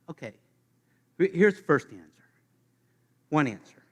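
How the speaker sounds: ordinary delivery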